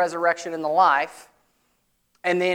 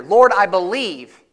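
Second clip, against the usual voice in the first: mock pious platitude; 3 semitones higher, 7 dB louder than usual. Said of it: "that's why I think it was spiritual platitude".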